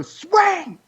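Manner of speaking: high shouted cheer; shouting at player. Retold or imitated. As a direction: yell it from the stands. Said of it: "I would go".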